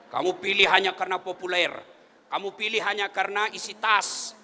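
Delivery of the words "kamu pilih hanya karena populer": ordinary talk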